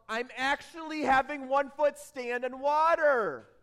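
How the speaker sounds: thrilled, incredulous outburst; amazed exclamation. Acted. Peter amazed at standing on water.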